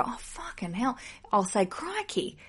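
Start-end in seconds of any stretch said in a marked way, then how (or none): 0.0-1.0 much softer, higher under-the-breath swear; soft, higher mock office curse; muttered curse. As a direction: curse quietly to yourself. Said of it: "when I can't go".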